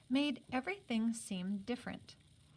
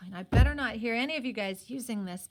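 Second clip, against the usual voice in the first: louder, fast aside warning; stern warning; 8 dB louder, faster than usual. Real, not acted. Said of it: "this is just the author".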